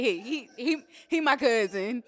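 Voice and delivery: loud teasing impression of a friend stammering a boast; mock boast. Teasing Jeremy for claiming Obama, loud stammering mock boast. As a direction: ham up a proud claim, loud. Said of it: "Jeremy said he claims him".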